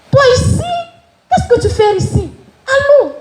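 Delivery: very high surprised mother, hurrying her along; mother's voice. Retold or imitated. The mother surprised to find her daughter there, very high. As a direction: play the startled mother.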